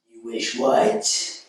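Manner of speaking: slow, rasping evil genie; creepy genie prompting his victim; Wishmaster. Acted. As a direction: draw it out in a menacing, whispery rasp. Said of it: "my best Wishmaster impression".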